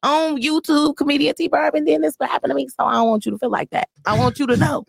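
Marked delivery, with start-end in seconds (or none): 0.0-2.7 excited fan. Retold or imitated. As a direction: imitate a breathless fan in a higher pitch. Imitates a fan recounting an accident.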